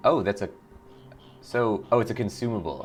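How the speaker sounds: usual speaking voice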